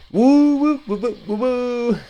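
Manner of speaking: high, loud siren-like woos; mock woo. Streamer mocking the news, high whooping. Mock cheering after "wasn't that great.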